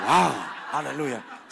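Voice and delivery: usual speaking voice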